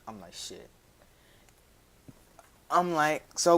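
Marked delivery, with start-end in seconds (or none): 0.3-0.7 muttered curse. Retold or imitated. Muttered reaction.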